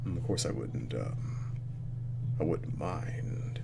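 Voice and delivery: slow, low near-whisper; hushed aside. Real, not acted. Player admitting he would like that too, hushed. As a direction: murmur it slowly under your breath.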